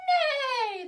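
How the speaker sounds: high, falling whinny; horse neigh. Acted. Showing children the horse's sound, neighing. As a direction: neigh high and let it slide down. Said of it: "he makes a sound like this".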